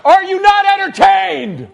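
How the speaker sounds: high booming arena shout; Gladiator shout. Pretend. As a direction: roar it at the crowd.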